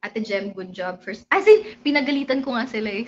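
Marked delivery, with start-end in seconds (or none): 0.0-1.2 calming team talk. Retold or imitated. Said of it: "kinausap na".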